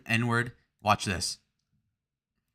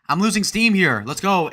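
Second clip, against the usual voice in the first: loud, raised self-hype; rallying himself; 7 semitones higher, 7 dB louder than usual. Real, not acted. Streamer admits he is losing steam, rallying loudly. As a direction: pump up a flagging show, higher and louder.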